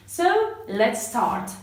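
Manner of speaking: usual speaking voice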